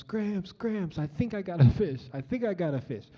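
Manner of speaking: high-pitched mock yell, easing off; half-held-back excited holler; retold excited yell. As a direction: re-enact calling out to grandpa about a catch. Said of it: "I start yelling to my Gramps".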